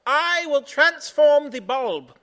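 high, loud, slow grand announcement; excited proclamation. Acted. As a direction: proclaim it like a mad inventor. Edison proclaims his invention.

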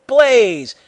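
loud, high battle shout. A narrator's reading. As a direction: shout it like an attack command. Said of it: "waved his hand and shouted".

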